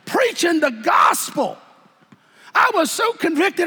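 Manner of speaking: high, loud preaching shout; preaching at full force. Acted. Preacher shouting his testimony to the church. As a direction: cry it out to the congregation.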